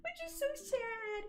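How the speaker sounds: very high, slow mock-sad whine; hammed-up squeaky pout; pouty lament. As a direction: draw it out in a tiny sad voice. Laments show ending in high voice.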